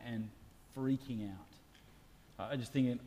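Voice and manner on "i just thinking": ordinary talk, nothing marked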